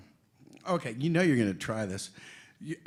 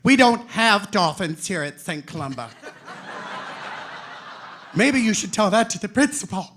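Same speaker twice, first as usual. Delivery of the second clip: loud, higher-pitched schoolteacher nun; scolding nun; 5 semitones higher, 10 dB louder than usual. Retold or imitated. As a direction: mimic a nun's loud reprimand. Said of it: "Sister Mary Agnes will say things like".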